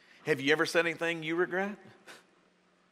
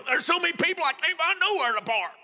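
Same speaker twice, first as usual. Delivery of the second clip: high, louder hammed-up complaint; mock whining; 12 semitones higher, 4 dB louder than usual. A grumbling driver with no place to park, in mock whine. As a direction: whine about the parking like a grumbler. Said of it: "Or do you go".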